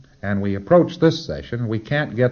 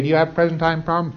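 brisk auditor question; 7 semitones higher, 3 dB louder, faster than usual. Shown as a demonstration. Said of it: "You say".

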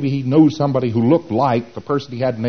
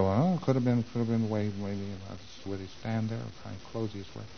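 very soft, tentative muttering; pondering preclear; 11 dB quieter than usual. Acted. Client guesses at what reminded him, in a hushed mutter. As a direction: think aloud under your breath, trailing away. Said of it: "he said".

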